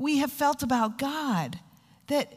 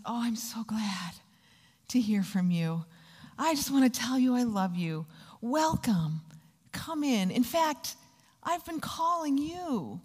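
voicing a welcoming God. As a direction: welcome them gently and lovingly. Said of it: "God says".

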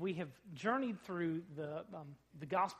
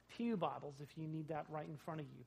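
ordinary talk

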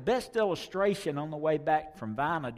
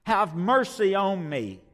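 pleading; 6 semitones higher, 7 dB louder than usual. Acted. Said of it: "Mercy is to feel with sympathy".

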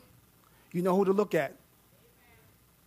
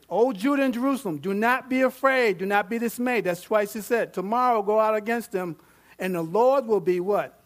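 higher and louder, preaching the prophet's command; fervent scripture preaching; 4 semitones higher, 6 dB louder than usual. Acted. Preacher reading the prophet's command to the congregation, fervent. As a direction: proclaim it like a battle order.

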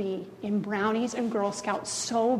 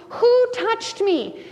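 slow, higher searching question; slowed, higher, intent question; Jesus asking; 8 semitones higher, 3 dB louder, much slower than usual. Acted. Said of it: "where Jesus goes".

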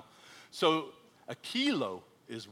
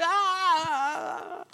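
long high loud "aah"; mock outburst; 6 dB louder than usual. Imitating the guy next to him, loud drawn-out high cry. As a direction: imitate a man bursting out in a high wail. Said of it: "the guy that was next to me just started".

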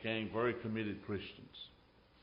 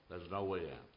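hushed despair; 7 semitones lower, 11 dB quieter than usual. Acted. Quiet echo of the cry.

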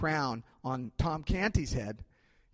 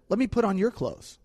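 playful, higher mock-eager offer; a lightly teasing prince proposing the swap; joking prince; 9 semitones higher, 6 dB louder than usual. Prince suggests swapping clothes with Tom, playfully. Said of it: "as a jest".

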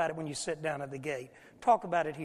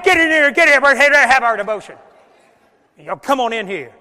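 much higher, loud exasperated shout; exasperated yell; 4 semitones higher, 15 dB louder than usual. Retold or imitated. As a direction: blow your top at the kids. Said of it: "my blood pressure's going up".

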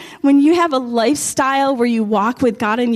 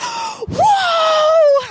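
long, very high-pitched "whoa"; re-enacted scream of excitement; excited scream. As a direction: squeal it out in amazement. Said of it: "I'm, like, screaming".

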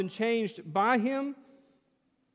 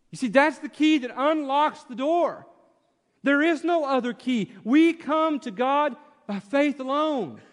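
raised, insistent pulpit voice; fervent preaching; 7 semitones higher, 3 dB louder than usual. Acted. Preacher building force to his congregation, raised and insistent. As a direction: press the point home to the church.

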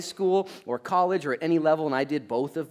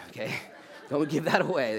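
normal talking voice

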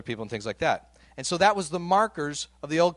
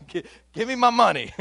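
loud, high, hammed-up demand; mock angry demand; 8 semitones higher, 7 dB louder than usual. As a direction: playfully act out a choker demanding cash. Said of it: "He just starts choking him".

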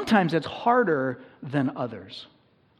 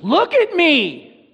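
much higher, louder, slow drawn-out cry; pain screaming; 13 semitones higher, 10 dB louder, much slower than usual. Retold or imitated. Pastor gives pain a voice crying out, high, loud and drawn out. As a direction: voice pain as it demands attention. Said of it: "pain screams".

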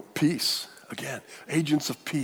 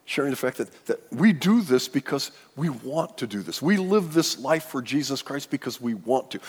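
normal talking voice

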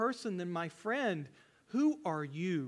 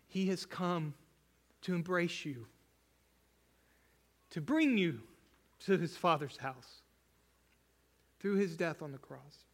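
emotional. Real, not acted. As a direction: let the feeling catch in the words. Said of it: "I'm gonna get emotional here".

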